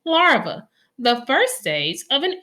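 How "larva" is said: teacher's headword Larva; vocabulary word announced. Shown as a demonstration. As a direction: call out Larva for the class. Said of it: "Look at these words we can learn".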